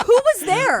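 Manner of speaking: high, loud puzzled question; surprised. Retold or imitated. Retold confused reaction.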